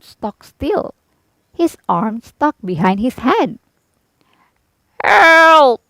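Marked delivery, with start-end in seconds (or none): 5.0-5.8 loud high strained cry; grunted cry for help. A narrator's reading. Rusted Tin Man grunts for help, loud and strained. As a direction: push out a stuck grunt. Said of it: "he grunted".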